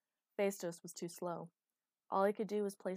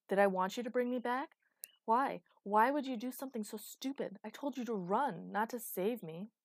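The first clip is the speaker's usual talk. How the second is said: loud, high, exasperated demands; heated outburst, pitched up; angry berating; 4 semitones higher, 6 dB louder than usual. Acted. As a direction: berate him with raised voice. Acted quarrel, Thunder berates him.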